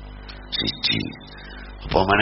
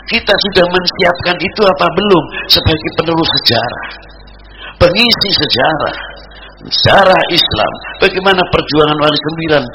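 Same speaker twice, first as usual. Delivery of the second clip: high, loud, rousing exhortation; forceful preaching; 8 semitones higher, 5 dB louder than usual. Acted. Preacher asking if they are ready to continue history, forceful preaching. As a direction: call the congregation to account.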